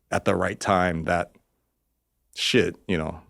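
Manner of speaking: ordinary delivery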